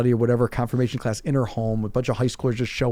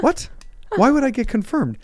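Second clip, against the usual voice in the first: incredulous; 13 semitones higher than usual. Retold or imitated.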